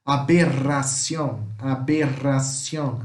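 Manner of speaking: slow "aberración" with trilled rr; Spanish aberración, drawn out on the trill; vibrato rr. Shown as a demonstration. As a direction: roll the rr, ending Spanish -ción. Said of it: "é um vibrato".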